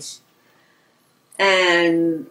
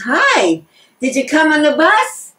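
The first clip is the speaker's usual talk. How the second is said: higher, louder, bright hello to a friend; pitched-up, eager greeting; calling out a greeting; 8 semitones higher, 5 dB louder, slower than usual. Acted. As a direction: call it across the room to a friend. Elderly lady greets friend across shop.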